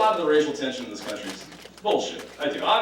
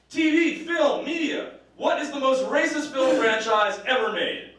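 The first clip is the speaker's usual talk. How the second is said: high mock rant; 10 semitones higher, slower than usual. Mounting mock rant to audience.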